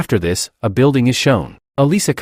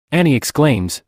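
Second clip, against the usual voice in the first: usual speaking voice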